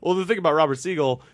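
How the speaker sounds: normal talking voice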